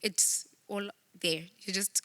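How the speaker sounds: normal talking voice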